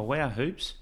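ordinary delivery

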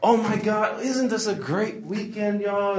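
mock gushing in a high, stretched-out voice; kindergarten-style church host; over-animated emcee. Emcee hyping the weekend to church, fake animated gushing. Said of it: "it's so animated".

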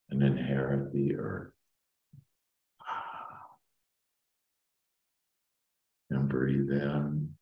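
slow, soft meditation voice; calm meditative tone. Acted. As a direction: ease them down into calm. Leads a guided meditation.

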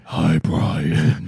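low breathy whisper; sultry voice. Retold or imitated. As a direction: purr it like a seductive caller. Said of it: "a sultry voice over".

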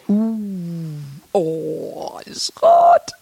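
hushed, stagey "uh-oh"; mock alarmed whisper. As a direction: whisper it like dreadful news, playfully. Acts alarmed reaction to red. (gespielt erschrockenes Flüstern)

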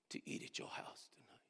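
hushed, moved whisper. Real, not acted. Preacher accepting woman's invitation, choked into a whisper. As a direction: barely breathe the words, overcome.

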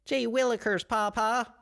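put-on high, chirpy old-timey child; gee-whiz innocent little kid; wholesome 1950s kid. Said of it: "that's how kids talk in the future".

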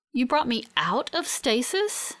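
plain reading voice

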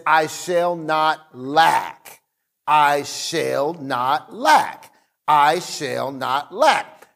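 very slow, every word weighed; slow emphatic recital. Shown as a demonstration. Pastor reciting the Amplified Bible version slowly. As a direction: drag out each word for weight. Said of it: "The Amplified Bible literally says".

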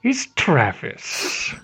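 low, hammy spooky host voice; hokey Halloween voice. Acted. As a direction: ham it up like a haunted host. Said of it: "terrible, hokey Halloween voices".

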